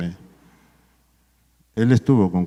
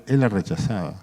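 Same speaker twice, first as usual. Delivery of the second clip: ordinary talk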